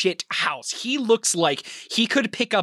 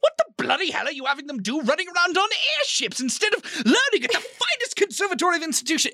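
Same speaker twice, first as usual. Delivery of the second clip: higher and fast, sputtering outrage; outraged headmaster; 8 semitones higher than usual. Acted. Headmaster scolding Atlas, half laughing. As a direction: blusters like an affronted old don.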